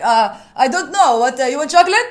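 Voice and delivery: put-on loud, high Arabic-accented English. Daughter answering puzzled friends in the car, Arabic accent. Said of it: "like an Arabic accent".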